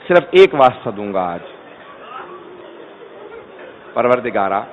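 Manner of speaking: low, soft, mournful recital; prayer lament. Acted. Preacher leads the mourners into prayer, low and soft. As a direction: hush into a grieving prayer.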